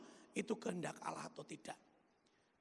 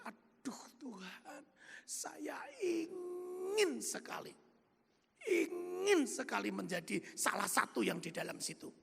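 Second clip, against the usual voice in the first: tearful pleading; 6 semitones higher than usual. Retold or imitated. Businessman recalls begging God in tears. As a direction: cry it out as a longing prayer. Crying prayer in retreat chapel.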